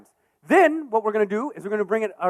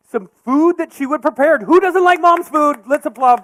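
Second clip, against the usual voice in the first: excited; 5 semitones higher, 10 dB louder than usual. Real, not acted. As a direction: pump up the crowd. Host hyping audience answer.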